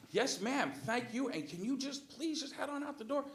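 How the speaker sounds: high, exaggeratedly polite request; placating politeness. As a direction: humor her while easing her out. Placating a screaming customer.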